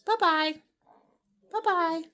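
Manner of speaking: high, loud sing-song farewell; sing-song goodbye. Acted. Owner singing goodbye to her parrot.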